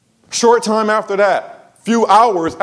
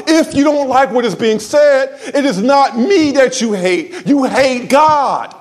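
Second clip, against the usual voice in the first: preaching rebuke; 4 semitones higher than usual. Retold or imitated. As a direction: rebuke him like a sermon. Preaching confrontation, rising.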